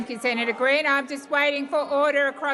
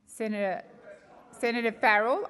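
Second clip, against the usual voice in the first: ordinary talk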